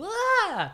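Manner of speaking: high short yelp; mock scream. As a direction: yelp it like she's pushed. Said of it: "you hear her go".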